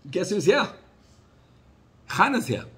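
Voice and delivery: high, loud breathless announcement; guard rushing in to announce her; excited news. Retold or imitated. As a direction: burst in with the big news. Guard running to tell master.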